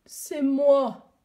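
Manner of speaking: a hoarse, moaning reply. Acted. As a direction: groan it out hoarsely. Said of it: "a gémi la voix enrouée". (gémissement rauque)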